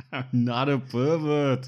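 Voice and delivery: loud, drawn-out mock protest; imitated shout. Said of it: "he shouts".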